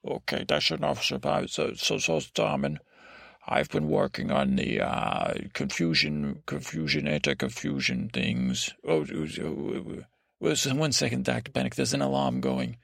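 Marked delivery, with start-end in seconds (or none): 3.5-7.1 deep doctor voice. Pretend. Doctor Panic character voice. 7.1-10.0 deep doctor voice. Pretend. Doctor Panic character voice.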